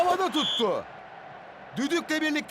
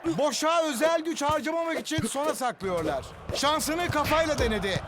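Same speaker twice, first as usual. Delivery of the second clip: ordinary talk, nothing marked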